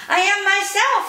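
a little one shouting happily to his waiting friends; joyful shout. A narrator's reading. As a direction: shout it out full of joy. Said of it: "he shouted, full of joy".

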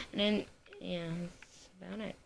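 normal talking voice

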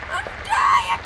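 very high, loud screams; panicked screaming. Real, not acted. Gamer dying on the fake beach, panicked shrieks. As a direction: shriek like you are dying.

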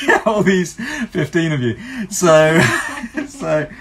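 laughing at the tiny audience. Real, not acted. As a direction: giggle through the joke. Joking about few viewers.

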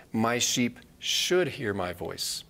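should stressed, whole line slow. Shown as a demonstration. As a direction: drag it out, lean on should. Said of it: "Jesus doesn't say".

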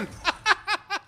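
high cackle; high staccato laughter; gleeful laugh. Real, not acted. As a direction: laugh at your own gag. Laughing at own radio joke.

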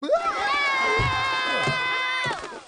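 high manic shout-laugh; hysterical laugh. Acted. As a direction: laugh wildly. Pigeon bursts out.

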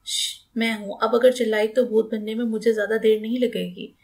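ordinary delivery, nothing marked

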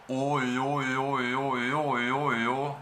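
slow repeated Ö after O; O-Ö drill. Shown as a demonstration. Teacher drilling Ö as a tongue game. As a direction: play the tongue game slowly. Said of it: "podríamos jugar un juego con este movimiento".

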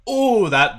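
high loud delighted oh; thrilled at the pop; excited. Real, not acted. Bottle pops open.

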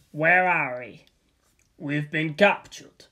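ordinary delivery, nothing marked

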